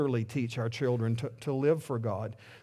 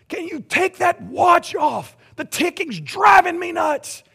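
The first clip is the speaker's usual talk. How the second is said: much higher, slower mock shout as the irritated son; annoyed son; 7 dB louder, slower than usual. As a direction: yell it like a fed-up teenager in the cab. Son complaining in the truck.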